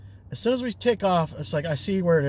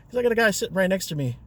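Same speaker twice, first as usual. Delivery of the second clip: ordinary talk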